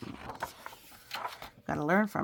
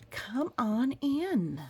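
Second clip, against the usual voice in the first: slow, higher welcome; inviting cookie; 8 semitones higher, 3 dB louder, much slower than usual. Acted. The cookie inviting the child into the bakery. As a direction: draw them in slowly as the cookie.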